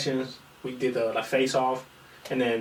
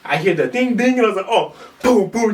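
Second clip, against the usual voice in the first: louder, higher, fast-building excitement; excited; 8 semitones higher, 6 dB louder than usual. Retold or imitated. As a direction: ramp up the thrill. Excited fight finale retelling.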